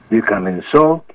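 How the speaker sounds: usual speaking voice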